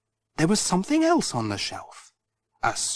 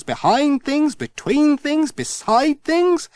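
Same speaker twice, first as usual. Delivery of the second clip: rising higher and more breathless with each item; building search frenzy; 8 semitones higher than usual. A narrator's reading. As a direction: pile it on, climbing in pitch to the end. Frantic search through cluttered workshop.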